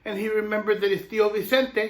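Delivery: plain reading voice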